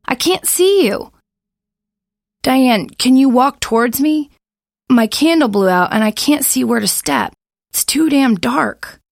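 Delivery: loud, high anxious shouting; calling out. Acted. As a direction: shout to be heard in the dark. Shouting across darkness.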